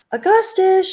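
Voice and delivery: high, loud call of the name; mother shouting. A narrator's reading. Mother shouting at her son by the chocolate river. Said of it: "shouted Missus Gloop".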